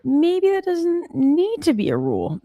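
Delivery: high, slow emphasis on that; emphatic. Real, not acted. Streamer stressing a point to her chat, high and slow. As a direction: lift it high and drag it out.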